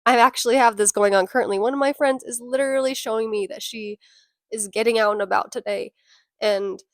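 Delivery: ordinary delivery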